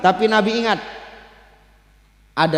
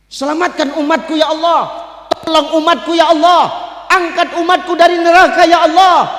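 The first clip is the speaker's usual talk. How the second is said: loud, high desperate plea; acted impassioned supplication, shouted; crying out in prayer; 9 semitones higher, 7 dB louder than usual. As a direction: beg at full voice, rising in force. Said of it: "menangis meminta kepada Allah".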